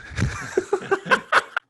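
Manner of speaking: high, bursting laugh; helpless high-pitched laughter; real laugh. Real, not acted. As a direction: crack up at your own story. Laughs at own punchline.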